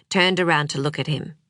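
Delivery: usual speaking voice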